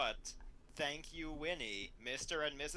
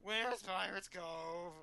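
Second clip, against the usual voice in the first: a hint of a high Betty voice; 5 semitones higher, slower than usual.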